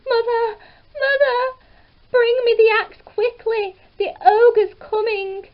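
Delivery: high, loud panicked shout; Jack shouting. A narrator's reading. Jack scrambles down calling his mother, loud panic. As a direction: yell down for help in a panic.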